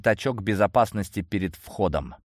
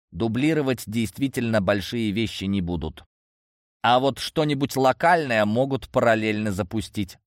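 plain reading voice

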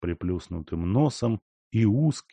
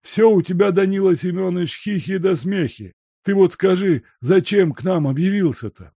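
put-on high, loud prying old man; fussy old servant; 11 semitones higher, 6 dB louder than usual. A narrator's reading. Servant pressing the guest why he came, high and prying. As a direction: needle him for the reason. Luka questioning.

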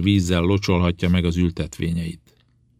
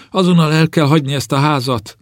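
high, loud cry of panic; alarmed shout; 9 semitones higher, 6 dB louder than usual. A narrator's reading. Servant girl warning her mistress of a curse, shouting. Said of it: "kiáltotta a szolgáló".

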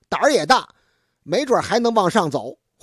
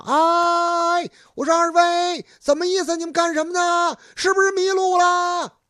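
higher, calling across the hills to two strangers; villager calling out; 8 semitones higher than usual. Acted. Villager calls to the two stranded young men, high and carrying. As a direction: hail them from the far ridge like a country villager. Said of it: "冲他们嚷嚷".

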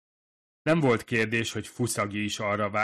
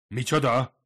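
loud, higher startled exclamation; surprised cry; 5 semitones higher, 9 dB louder than usual. A narrator's reading. Eavesdropper learning of a rival, crying out surprised. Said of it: "kiáltott föl meglepetten".

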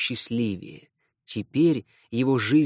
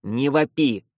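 irritated shout. Acted. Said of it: "раздражённо крикнул вампир".

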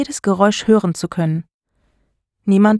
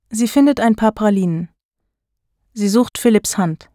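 plain reading voice

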